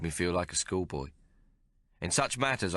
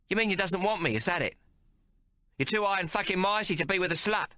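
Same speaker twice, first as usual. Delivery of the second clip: Maggie, much higher and harsh with rage; furious; 14 semitones higher than usual. Acted. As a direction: spit it at him, wounded and angry. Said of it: "She was furious".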